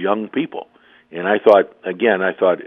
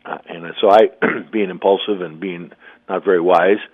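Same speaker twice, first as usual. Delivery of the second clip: normal talking voice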